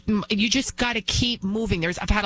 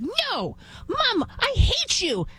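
high, drawn-out bratty protest; hammed-up little-kid whine; whiny boy imitation; slower than usual. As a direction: mimic a spoiled child defying his mother. Imitates whiny boy talking back to mom.

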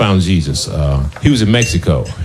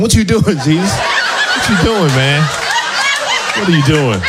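high, laughing disbelief; incredulous stoner; 10 semitones higher than usual. Retold or imitated. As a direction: ask it in giggling disbelief. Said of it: "I'm like".

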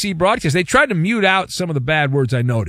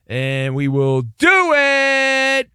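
very slow, climbing to a high held note; theatrical radio tease; 9 semitones higher, much slower than usual. Real, not acted. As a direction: stretch "do it" out high.

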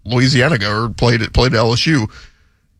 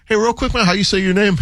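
higher-pitched casual interviewer voice; imitated interviewer; 6 semitones higher than usual. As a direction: toss out a quick friendly question, higher up. Acts interviewer asking player.

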